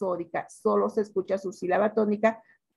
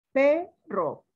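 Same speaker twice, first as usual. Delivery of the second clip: "Perro" split in two, higher PE, falling RO; stress on first syllable; 4 semitones higher than usual. Shown as a demonstration. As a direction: draw out the PE in "Perro" for the class. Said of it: "Cuál es la sílaba tónica".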